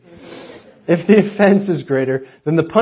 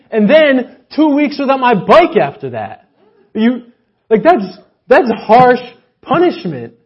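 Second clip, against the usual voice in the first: high, loud mock outrage; building preacher's outrage; indignant rant; 8 semitones higher, 9 dB louder than usual. Real, not acted. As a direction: rail against it, wide-eyed at the harshness. Preacher rants about harsh punishment.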